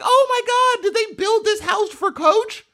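high, excited squeal of recognition; a high, hammed-up fan squeal; mock excited gasp. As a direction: gush like a starstruck tourist. Retelling his excited tour reaction.

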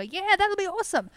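high, excited acted reply; eager agreement. Pig owner agreeing to the rally plan, excited. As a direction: pitch it up in mock eagerness.

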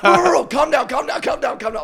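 high, loud, urgent retold pleading; frantic calming. Host grabbing his raging roommate, frantic high calming. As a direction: act out rushing to restrain someone.

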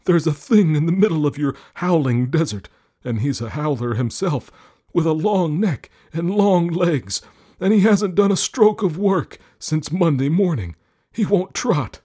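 high, loud, eager horse. Acted. Horse complains about camel.

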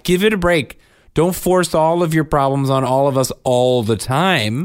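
acting out a fed-up comeback; exasperated retort. To an oversharer who won't stop, exasperated.

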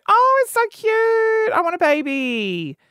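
high, slow cooing gush; gushing over a baby. Retold or imitated. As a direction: coo like a smitten aunt. Cooing over babies, mock gushing.

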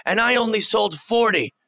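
high-pitched mock yell of complaint; Kevin Owens. Wrestler bragging and complaining about merch, mock yelling. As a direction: imitate a wrestler going off about poor sales. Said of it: "when he's just yelling about how".

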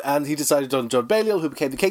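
ordinary delivery

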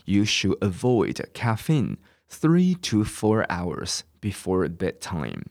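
slow, measured English model sentence; repeat-after-me reading. Shown as a demonstration. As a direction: read it out slowly, phrase by phrase, for learners. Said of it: "我再念一次哦".

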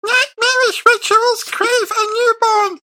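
very high, slow, loud creature voice; bagman voice. Acted. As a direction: read it slowly in an absurdly high put-on monster voice. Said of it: "read it in your best bagman voice".

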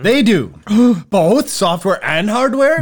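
high gasping infomercial wonder; gasp, then draw it out in disbelief; mock amazement. Host hyping a sponsor's services, mock amazed. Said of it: "Could you sound excited about them?".

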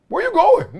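high, amused where-you-going, laughing underneath; half-laughing high incredulous questions; incredulous mock disbelief. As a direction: tease him like he's lost his mind.

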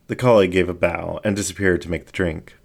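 usual speaking voice

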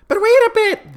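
very high, loud oyster squeal; crying-out little oysters. A narrator's reading. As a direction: protest as tiny oysters. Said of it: "the oysters cried".

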